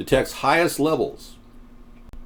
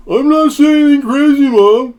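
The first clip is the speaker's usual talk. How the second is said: son's voice; 14 semitones higher, 9 dB louder than usual. Retold or imitated. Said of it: "His mom was like".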